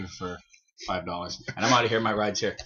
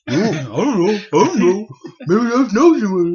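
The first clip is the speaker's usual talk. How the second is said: Eric voice; 6 semitones higher, 7 dB louder, slower than usual. Acted. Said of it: "That's my Eric".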